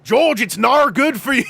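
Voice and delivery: high, loud Australian warning; hammed-up Aussie friend pleading loudly; broad Australian accent. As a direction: overdo the Australian vowels as you scold. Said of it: "whenever I try to do Australian".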